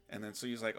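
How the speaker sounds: usual speaking voice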